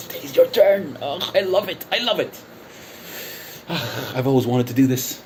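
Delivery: relishing it, pitched well above normal; gloating relish. Real, not acted. Relishing his turn to expose rival.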